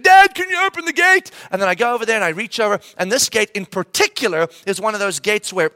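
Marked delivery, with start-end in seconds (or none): 0.0-1.3 put-on high, loud whiny kid voice; kid asking. Kid pestering dad to open the gate, high whine.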